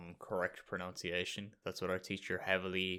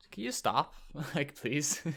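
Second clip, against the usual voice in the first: high, louder mock-exasperated thought, laughing; exasperated plea; 6 semitones higher, 4 dB louder, slower than usual. Classmate silently begging a girl to drop her fake French accent, mock exasperation. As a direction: plead in pretend exasperation. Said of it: "just thinking".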